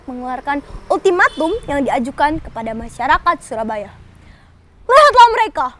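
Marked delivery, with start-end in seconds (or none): 4.9-5.7 loud, high outraged call; indignant. Acted. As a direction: rouse the others. Indignant fighter.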